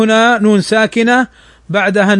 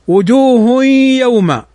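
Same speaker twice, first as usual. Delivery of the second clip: Quran recitation; 3 semitones higher, slower than usual. Shown as a demonstration.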